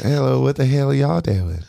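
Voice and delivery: a hint of Leslie Jordan's Southern lilt; Southern impression. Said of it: "He's like, he's like".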